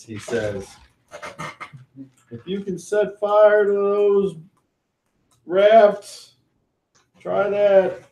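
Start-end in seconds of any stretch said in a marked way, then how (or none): 2.3-8.0 high-pitched NPC voice. Acted. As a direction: voice jumps up high and louder. NPC parting words, high.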